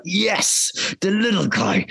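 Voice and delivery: high, triumphant little cry of joy; excited cheer. Retold or imitated. Recalling reading the script, cheering the underdog, excited.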